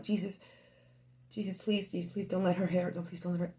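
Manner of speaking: very soft, low pleading; hushed desperate prayer. Retold or imitated. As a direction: beg Jesus under your breath. Praying child is not hit.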